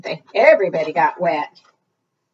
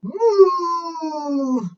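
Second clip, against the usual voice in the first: long, high moo sliding down; sustained cow call; 8 semitones higher than usual. Acted. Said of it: "What does the cow say?".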